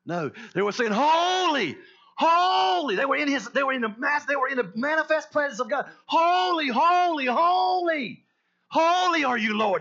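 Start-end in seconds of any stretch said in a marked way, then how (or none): 0.9-3.0 loud, high passionate cry; screaming. Shown as a demonstration. Preacher shows how the angels cried to God, screaming. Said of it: "They were screaming it". 6.0-9.8 loud, high, passionate shout of worship; screaming. Shown as a demonstration. Angels in God's manifest presence praise him, screaming. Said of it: "They were screaming it".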